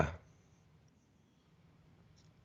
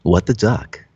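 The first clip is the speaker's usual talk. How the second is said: radio announcer voice; 3 semitones lower than usual. Shown as a demonstration. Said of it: "We need to get your radio voice going".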